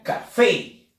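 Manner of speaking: "café" with an emphasized final FÉ; teacher demonstrating the strong FÉ in "café"; stress on FÉ. Shown as a demonstration. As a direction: hit the last syllable of "café". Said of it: "lleva fuerza de voz en la sílaba fe".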